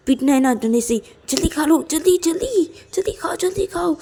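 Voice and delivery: high, insistent nagging on repeated words; frantic urging. Acted. Ananya keeps telling Payal to eat quickly, frantic urging.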